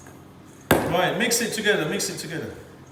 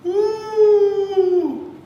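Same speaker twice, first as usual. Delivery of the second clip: long high delighted ooh; 13 semitones higher, 6 dB louder than usual. Real, not acted. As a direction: coo in delight at the food. Delighted cry at the dish.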